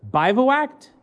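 "Bivouacked" sounded out as a high question; tentative, questioning stab at "Bivouacked"; unsure pronunciation. Real, not acted. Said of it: "I don't know how to say this word".

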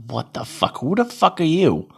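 retelling his own stunned reaction; incredulous outburst. Retold or imitated. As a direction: blurt it out, stunned and offended. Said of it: "I was like".

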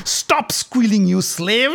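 mock her cries; jeering. Dussasana jeering at the fallen queen, slow and mocking. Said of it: "and jeered".

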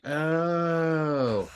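retold slow-dawning "ohhh"; dawning realisation. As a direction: stretch it out as it finally sinks in. Retold moment of finally understanding.

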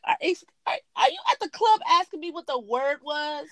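can't believe he's asking this; incredulous retort. Retold or imitated.

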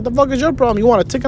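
retold scolding retort. Cousin's retort in acted quarrel.

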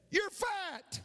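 very high, falling taunt; acted high holler. Boy taunting the unseen voice, high holler. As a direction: call the insult out high. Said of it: "he screamed out".

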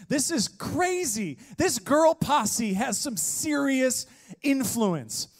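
high, slow and amazed; excited. Real, not acted. Preacher marvels to the congregation, excited. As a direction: marvel at it to the crowd.

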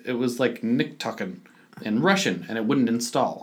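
ordinary delivery

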